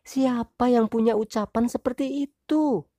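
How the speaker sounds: high wife voice, retorting; wife's retort. Acted. Wife throwing husband's question back, high voice. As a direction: turn his words back on him.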